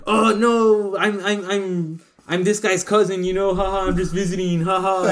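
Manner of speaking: high, hammed-up fake-friendly intruder; mock nervous excuse. Imagined intruder passing as a cousin, mock nervous excuse. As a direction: stammer out a phony excuse with fake chuckles.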